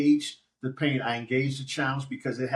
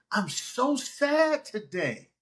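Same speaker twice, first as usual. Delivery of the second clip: sad admission; 8 semitones higher, much slower than usual. Retold or imitated. Voicing his own admission.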